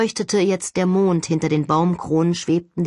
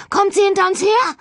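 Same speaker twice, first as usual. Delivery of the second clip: high, loud panicked question; Holly, fleeing the ghost, asks in panic; frightened; 6 dB louder than usual. Acted. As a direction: ask in sheer panic, voice up high. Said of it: "fragte Holly ängstlich".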